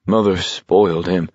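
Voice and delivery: subdued, trembling voice; understated, upset quaver. A narrator's reading. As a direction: say it with a slight tremble. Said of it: "said in a trembling voice".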